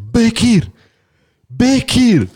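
high loud call across the room. Retold or imitated. Calling friend over the noise, loud high call. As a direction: call his name over the chatter.

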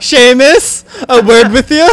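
high, loud, laughing put-on brogue; mock Irish-Scottish. Friend calling Seamus aside in a laughing mock brogue. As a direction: call him over in a hammed brogue.

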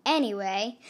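ordinary delivery, nothing marked

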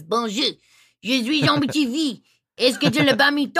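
loud, high, emphatic Cajun French; Cajun French accent. Shown as a demonstration. Voice actor showing how Cajun French differs from standard, broad Cajun French accent. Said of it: "But in Cajun French, it's".

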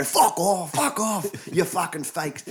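swearing tirade. Retold or imitated. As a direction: bark insults like an angry TV chef. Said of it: "and then he'd go".

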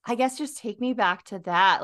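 ordinary talk

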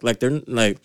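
normal talking voice

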